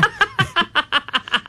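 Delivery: high, rapid chuckle; laughing. Real, not acted. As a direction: run of high staccato laughter. Laughs after own joking claim.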